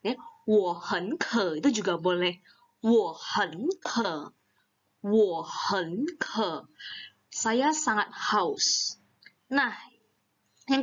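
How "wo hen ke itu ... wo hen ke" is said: "Wo hen ke" spoken very slowly, word by word; slow model sentence. Shown as a demonstration. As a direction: model it slowly for learners to repeat. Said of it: "teacher modeling a sentence". ("Wo hen ke" diucapkan sangat pelan)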